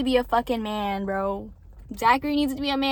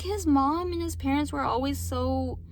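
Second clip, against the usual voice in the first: ordinary talk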